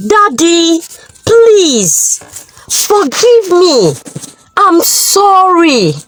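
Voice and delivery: lightly voiced sobbing apology; crying daughter. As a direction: beg daddy while weeping. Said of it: "who was still crying".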